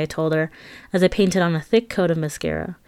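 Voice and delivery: ordinary delivery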